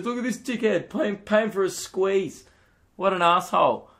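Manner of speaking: high jeering bystander voice; mocking onlooker. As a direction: sneer at the guy paying for a squeeze. Onlookers mocking the sauce payer.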